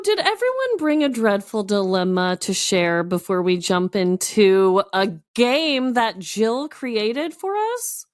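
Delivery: ordinary talk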